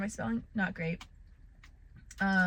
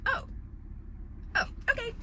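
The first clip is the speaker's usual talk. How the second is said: put-on small relieved child; 13 semitones higher, 7 dB quieter, faster than usual. Child comforted.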